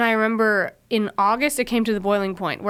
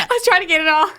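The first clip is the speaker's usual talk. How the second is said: laughing; 7 semitones higher, 5 dB louder, faster than usual. Real, not acted.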